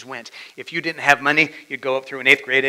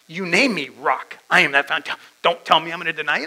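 heated protest, higher and louder; indignant Peter; 4 semitones higher, 5 dB louder than usual. Acted. Peter argues with Jesus over the predicted denial, indignant. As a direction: push back hard, rushing the last words. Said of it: "That's Peter's heart".

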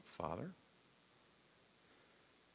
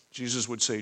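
ordinary delivery

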